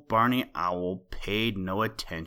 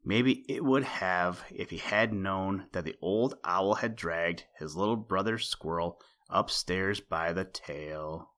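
ordinary delivery, nothing marked

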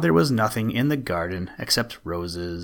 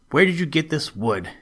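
higher, sharp accusing question; angry cry; 5 semitones higher than usual. A narrator's reading. Stepmother confronting Pedro over the wood, mildly angry. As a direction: demand an answer from the boy. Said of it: "cried the woman angrily".

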